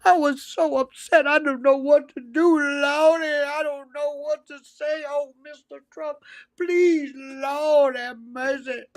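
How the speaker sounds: high, mock-fretful pearl-clutching voice; high whiny mock lament; mocking pearl-clutcher. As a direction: ham up a fussy pearl-clutcher. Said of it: "oh, clutching your pearls".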